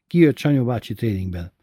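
ordinary delivery

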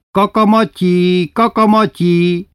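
slow, high, loud taunting call; jeering yell. Acted. Town kids taunting the coachman, high slow yell. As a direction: yell the nickname across the street like a street kid. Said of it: "Így kiabálta utána a város összes gyereke".

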